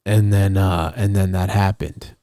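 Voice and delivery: usual speaking voice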